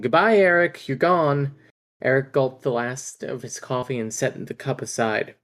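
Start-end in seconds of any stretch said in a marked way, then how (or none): 0.0-1.5 bitter farewell. Acted. Son's bitter quote read aloud.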